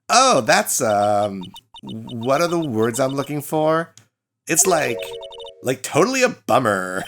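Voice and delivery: lightly voiced ditzy Valley Girl; Valley Girl accent. Student greeting the lawyer in a Valley Girl accent. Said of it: "another Valley Girl accent".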